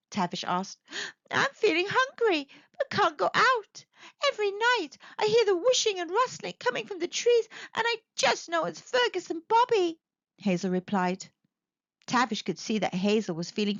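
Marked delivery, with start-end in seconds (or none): 1.3-10.0 high, timid hedgehog voice; a small frightened hedgehog confiding; scared little hedgehog. Acted. Hedgehog telling the owl her fears, high and timid.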